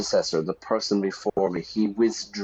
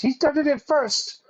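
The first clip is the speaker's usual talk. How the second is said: very high mock-childish whine; squealed protest; 3 dB louder than usual. President blames rival to David, squealed protest. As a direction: squeak out the accusation, far above usual voice.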